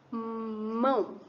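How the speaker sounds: "mão" said as one held syllable; single-syllable demo. Shown as a demonstration. Teacher showing pupils one syllable, single-beat demo. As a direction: say "mão" in one drawn-out opening of the mouth. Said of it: "você só fala uma vez".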